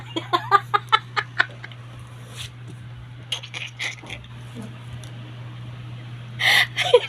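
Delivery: breathless wheezing laugh; long high laughter. Real, not acted. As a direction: crack up helplessly. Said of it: "Natatawa, natatawa ako".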